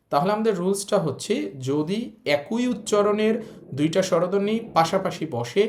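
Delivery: ordinary talk, nothing marked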